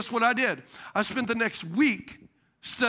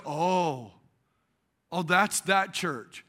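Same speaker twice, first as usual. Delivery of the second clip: disapproving repairman; 6 semitones lower, much slower than usual. Retold or imitated.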